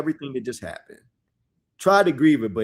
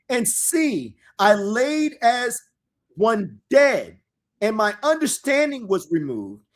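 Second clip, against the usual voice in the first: dramatic scripture reading; 5 semitones higher than usual. Acted.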